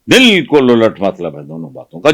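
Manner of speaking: ordinary talk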